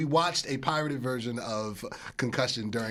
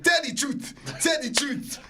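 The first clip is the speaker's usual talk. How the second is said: high-pitched mock African movie accent; Will Smith's African accent; 11 semitones higher than usual. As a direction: mimic a bad African film accent, pitched way up. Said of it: "this dude's accent was straight Will Smith from Concussion".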